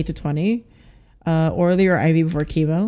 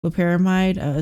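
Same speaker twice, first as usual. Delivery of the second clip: normal talking voice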